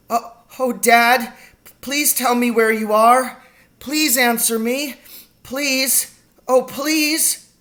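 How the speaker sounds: high, trembly desperate plea; trembly pleading. A narrator's reading. Lost boy begs his missing father to answer, trembly. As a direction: beg with a stammer, pitched high. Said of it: "my voice began to go all trembly".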